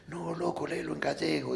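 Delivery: a light imitation of a rocker talking like [name]; a hint of a [name]-like rocker. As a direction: quote the heavy guy lightly, [name] style. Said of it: "dice, así como [name]".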